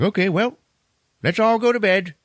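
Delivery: high, silly put-on voice; mock chipper group. Group around the fire turning in, in high silly voice. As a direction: pipe it up high, goofily.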